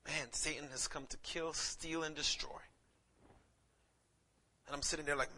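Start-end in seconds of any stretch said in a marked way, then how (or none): none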